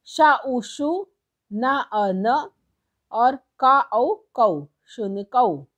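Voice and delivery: slow, deliberate syllable blending; teacher slowly blending letters into शौचिकः; slow spelling out. Shown as a demonstration. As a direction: sound out each letter pair, then join them. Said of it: "जोड़ के एक शब्द बनाना है".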